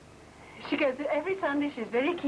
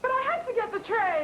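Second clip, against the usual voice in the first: upset protest; 10 semitones higher than usual. Acted. Child protesting to her mother.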